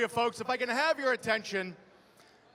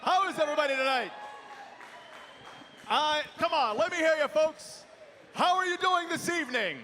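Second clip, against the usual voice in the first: arena announcer; 3 semitones higher than usual. Real, not acted. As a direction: fire up the crowd from the cage. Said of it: "to our ring announcer".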